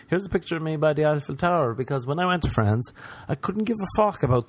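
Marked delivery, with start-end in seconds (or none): none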